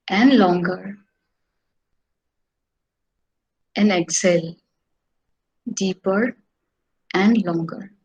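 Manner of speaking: guided meditation. Acted. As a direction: lead the breathing calmly.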